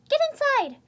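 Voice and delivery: loud urgent order; shouted. Acted. Otacon ordering Snake to take cover, loud shout. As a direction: yell it as troopers charge. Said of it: "I shouted".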